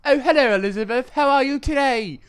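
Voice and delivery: high, loud, posh-sounding mock British; mock British accent. Shown as a demonstration. Trying his British accent on his friend, hammed-up. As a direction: ham up a stuffy Englishman. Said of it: "my British one".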